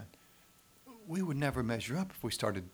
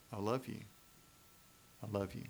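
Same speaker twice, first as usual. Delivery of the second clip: gently, softer, as God speaking; tender whisper-soft love; 7 dB quieter, faster than usual. Pretend. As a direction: say it like a quiet embrace. God's tender words at table.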